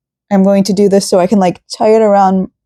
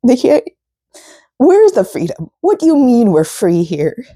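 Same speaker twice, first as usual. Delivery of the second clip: tearful outburst; 5 semitones higher than usual. Real, not acted. Upset outburst about the country.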